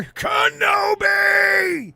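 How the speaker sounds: slow high drawn-out call; drawn-out shout. Acted. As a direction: call it out slowly like a chant. Chanting the show name.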